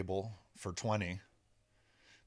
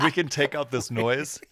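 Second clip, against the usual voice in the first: raised, louder, through laughter; laughing retold quote; 4 semitones higher, 8 dB louder than usual. Musician retells the production team's offer, laughing. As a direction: quote them while still laughing.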